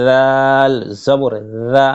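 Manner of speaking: দাল জবর দা slowly, দ light and thin; thin dal. Shown as a demonstration. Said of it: "দাল পাতলা করে". (দাল পাতলা করে ধীরে টেনে পড়া)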